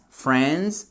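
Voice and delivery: "friends" corrected to one syllable, "frendz"; correct English pronunciation. Shown as a demonstration. Teacher restating after the mistake, "friends" said correctly as "frendz". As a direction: say "friends" with a short e and silent i. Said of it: "et non pas friends".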